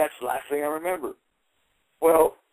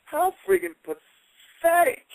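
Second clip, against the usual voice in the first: higher, slowly drawn-out scorn; slow contempt; 12 semitones higher, much slower than usual. Retold or imitated. To the man backing down, slow higher scorn. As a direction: stretch out the contempt. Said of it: "I said".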